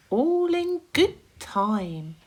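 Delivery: slow, high unhurried glowworm. Acted. Glowworm answering the impatient centipede, unhurried. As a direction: drag it out, in no hurry.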